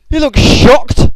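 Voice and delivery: high, slow squeal of amazement; amazed squeal at a fallen soldier; excited squeal. Real, not acted. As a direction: stretch it out in a high, loud, astonished voice. Gasping at battle replay.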